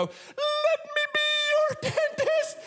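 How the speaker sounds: slow, extreme falsetto crescendo; piercing drawn-out high wail; highest possible voice. Acted. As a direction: stretch it out at the very top of your range. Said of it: "in the highest voice I can do".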